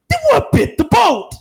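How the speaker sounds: high, loud mock outrage; shouted mock indignation; incredulous shout. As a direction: yell it like the obvious is ignored. Mocking doubters in film recap.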